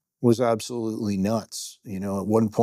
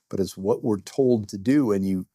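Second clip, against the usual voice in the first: ordinary talk, nothing marked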